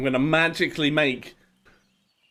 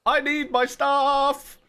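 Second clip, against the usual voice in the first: terrified gibbering; 11 semitones higher, slower than usual. Real, not acted. Fleeing mobs in panic.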